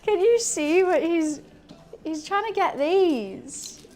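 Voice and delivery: high, playful voice for the cat; pet-talk voice. Real, not acted. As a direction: coo at the naughty cat.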